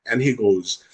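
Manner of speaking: usual speaking voice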